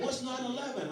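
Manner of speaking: put-on high-pitched child's question; puzzled little niece. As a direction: mimic a small child asking innocently. Young niece asks, imitated.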